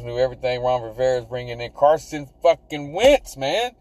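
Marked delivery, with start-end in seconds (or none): none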